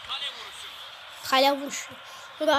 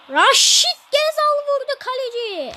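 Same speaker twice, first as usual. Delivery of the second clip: raised, excited match call; excited commentator; 8 semitones higher, 6 dB louder than usual. Acted. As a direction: call the shot like live TV. Playing commentator over football game.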